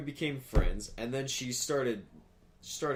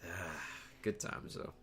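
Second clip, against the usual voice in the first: softer and softer, then whispered; soft, fading sigh; 6 semitones lower, 12 dB quieter, slower than usual. Real, not acted. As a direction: let it trail off into a whisper. Wistful ironic reminiscence.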